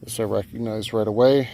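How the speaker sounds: ordinary talk, nothing marked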